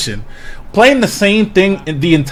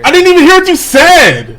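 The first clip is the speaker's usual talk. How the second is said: high-pitched outraged protest; indignant protest; 10 semitones higher than usual. Real, not acted. Co-host pranked on air, protesting indignantly.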